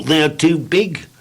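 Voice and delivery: slow mournful complaint. Acted. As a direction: moan about the legs slowly. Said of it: "explained Mister Tall".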